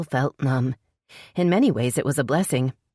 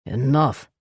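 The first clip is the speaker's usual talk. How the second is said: growled. A narrator's reading. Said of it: "he growled".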